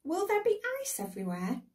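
put-on very high childlike question; little girl. A narrator's reading. Daughter quizzing her father before his journey, little girl voice. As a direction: pitch it right up as a small girl. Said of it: "said the little girl Janet".

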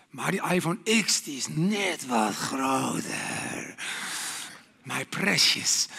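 low, slow creepy craving voice; Gollum. Retold or imitated. Preacher doing Gollum over a new iPhone. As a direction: croon over the phone like Gollum. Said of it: "je kent ook de film".

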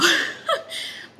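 high breathy laugh out of a yawn. Real, not acted. Reader laughing at her own yawn mid-reading, breathy laugh. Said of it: "I'm yawning now".

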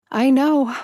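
high, teary, blubbering voice; overjoyed sobbing cry. Acted. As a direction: choke it out through happy tears. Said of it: "I blubbered".

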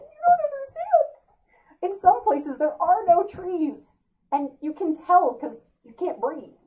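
mock frantic plea. Host insisting to viewers that trees matter, hammed-up frantic plea. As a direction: plead as if it's a crisis. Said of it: "but I'm just like".

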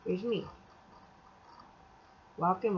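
ordinary delivery

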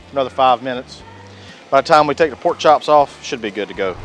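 usual speaking voice

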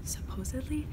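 whispering. Real, not acted. Sneaking into haunted hotel room.

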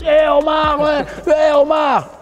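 high calling shout across the street. Retold or imitated. A fan on a bus calls out to the commentator, shouting. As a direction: hail him from a passing bus. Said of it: "le bus".

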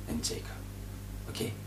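soft whisper; whispered aside. Real, not acted. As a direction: drop to a whisper on "and Jacob". Whispered end of a list.

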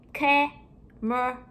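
CAMERA split into three slow, separate syllables. Shown as a demonstration. Teacher breaking down a word for pupils, syllable by syllable. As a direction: say CAMERA in drawn-out chunks with gaps.

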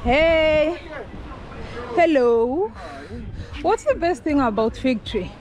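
ordinary talk, nothing marked

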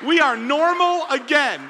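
loud, high, drawn-out celebration; big victorious announcement; triumphant cheer. Real, not acted. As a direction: proclaim it slowly and jubilantly to the crowd. Celebrating the child sleeping through.

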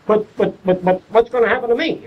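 high-pitched, stammering mock alarm; flustered protest. Doctors fretting about being replaced by machines, mock flustered. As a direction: stammer in imitation of a worried doctor.

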